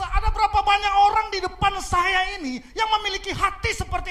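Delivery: loud, fervent pulpit preaching; preaching at full force. Acted. Pastor challenges the congregation, preaching loud and fervent. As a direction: drive the point home to the whole congregation.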